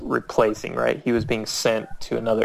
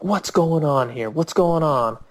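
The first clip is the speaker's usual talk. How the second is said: higher-pitched mock outrage; indignant demand; 5 semitones higher than usual. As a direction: imitate a man storming in demanding answers. Man storming downstairs demands answers.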